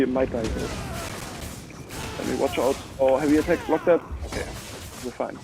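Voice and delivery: normal talking voice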